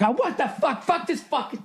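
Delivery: high strangled mock rage; flipping out. Him losing it over the setup, high mock fury. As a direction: choke it out, losing it. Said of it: "I'd be like".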